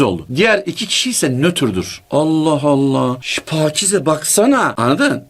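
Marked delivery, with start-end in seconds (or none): 2.1-4.7 curious gossip aside. Retold or imitated. Neutral neighbor whispering to Pakize.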